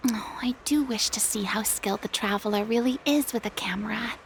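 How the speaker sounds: private whisper to herself; whispered aside. Acted. Farina to herself about the Traveler, a soft whispered aside. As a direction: breathe it as a secret aside.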